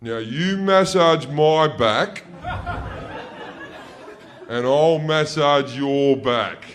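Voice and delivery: put-on slow, sly older brother; slow mock-sincere big brother offer; scheming brother. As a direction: con the little brother. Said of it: "my brother looks at me and he goes".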